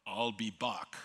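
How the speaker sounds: put-on lightly done Austrian accent, like the movie robot; a hint of an Austrian action-hero accent. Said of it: "with an Austrian accent".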